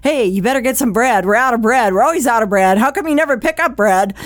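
rapid-fire, exasperated complaint; pushy griping at a spouse; nagging demand. Acted. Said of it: "probably going to start a fight".

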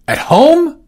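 very high, loud incredulous echo; musing surprise. Acted. Orsino echoing the news in disbelief. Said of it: "he mused".

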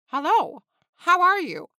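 loud, high hello, then a falling "how are you"; punchy and over-keen practice greeting; practised greeting. A narrator's reading. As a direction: rehearse a bold, emphatic greeting. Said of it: "He liked the last one best".

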